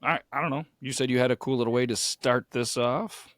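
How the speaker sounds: normal talking voice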